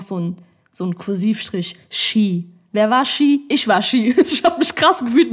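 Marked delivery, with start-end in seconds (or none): 4.2-5.3 higher and louder, laughing. Real, not acted. Laughs at old self.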